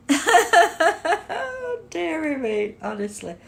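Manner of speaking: high sigh breaking through laughter; helpless laughter. Real, not acted. Streamer who can't cope with Lee, laughing helplessly. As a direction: come down from a real laughing fit. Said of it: "Makes me laugh".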